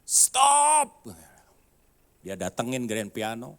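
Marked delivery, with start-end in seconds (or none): 0.0-1.1 high, loud shout of a foreigner; angry foreigner shouting stop. Retold or imitated. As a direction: yell it to cut off the piano. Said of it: "Dia teriak".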